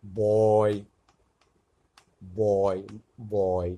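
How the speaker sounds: বয় said loud and drawn out, opening on a firm B; B sound demonstrated. Shown as a demonstration. Teacher showing learners the letter B sound in বয়, loud demonstration. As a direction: model বয় loudly, one careful word at a time. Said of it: "এই বয়ের মতো উচ্চারণ লাগছে".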